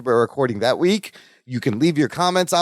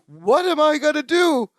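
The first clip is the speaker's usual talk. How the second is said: mock panic; 11 semitones higher than usual. Voicing Balky panicking.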